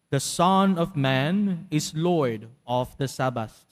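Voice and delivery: ordinary talk, nothing marked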